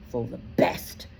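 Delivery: a hushed, breathy sneer; scornful whisper. A narrator's reading. Bane mocking Ronan's words, whispered. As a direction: spit it out under the breath.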